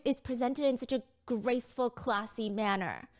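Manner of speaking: normal talking voice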